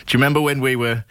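ordinary talk

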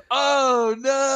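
put-on high, drawn-out drunken wail; loud drunk voice. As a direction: imitate a drunk friend wailing loudly. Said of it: "Pat's loud, drunk voice, like".

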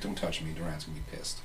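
quiet, frightened servant voice; scared servant. Acted. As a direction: shrink back, voice dropping low. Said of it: "He's like".

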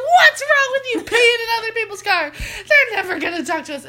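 high, loud mock scolding; exasperated parent telling off a child; imitated angry mom. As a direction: yell it like an outraged mother. Retells mom scolding her.

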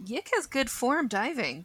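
ordinary delivery

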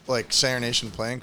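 ordinary talk

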